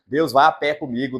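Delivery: ordinary delivery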